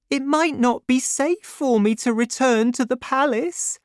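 put-on high, light grateful young woman. A narrator's reading. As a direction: lift the voice for the young heroine.

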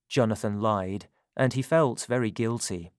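ordinary delivery, nothing marked

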